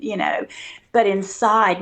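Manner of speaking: ordinary talk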